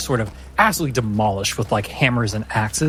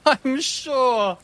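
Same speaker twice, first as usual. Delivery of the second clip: much higher, laughing mockery; mocking laugh; slower than usual. Anaïs laughs at the player's excuse, high and mocking. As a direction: scoff through laughter.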